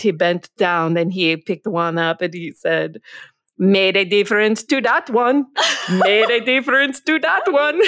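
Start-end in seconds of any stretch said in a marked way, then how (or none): 3.6-7.8 put-on amateur Jamaican accent, higher voice, breaking into laughter. Retelling a friend's starfish story to the co-host, imitated Jamaican accent. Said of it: "in this great Jamaican accent".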